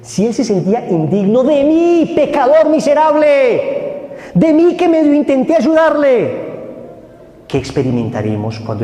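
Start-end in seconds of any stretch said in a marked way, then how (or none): 0.0-6.5 high, loud and slow, pressing each word; preaching at full force. Real, not acted. Preacher pressing his flock at the climax. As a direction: hammer home the point to the congregation.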